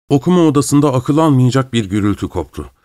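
ordinary delivery, nothing marked

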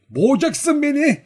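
very high, loud playful protest; laughing old woman's shout. A narrator's reading. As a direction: cry out in mock alarm as an old woman. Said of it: "diye bağırdı ona".

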